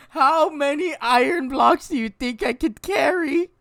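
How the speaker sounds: high, loud, slow, cracking up; laughing through words. Real, not acted. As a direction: barely get the line out for laughing. Repeats line while laughing.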